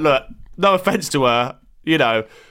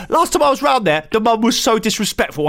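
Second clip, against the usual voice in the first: higher, lightly voiced indignant complaint; indignant woman; 7 semitones higher than usual. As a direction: imitate her outrage at the mum. Voicing the tube woman's complaint.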